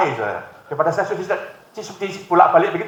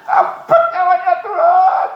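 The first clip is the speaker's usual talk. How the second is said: high, loud, slow mock cry with a laugh in it; mock wailing shout; 6 dB louder, much slower than usual. Wife begs God to take her husband, mock wailing shout. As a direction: belt the plea out half laughing. Wife's prayer repeated louder.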